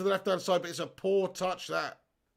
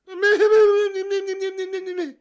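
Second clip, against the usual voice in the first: squeaky mimicking laugh; 14 semitones higher, 12 dB louder than usual. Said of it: "When does Nicola talk like that".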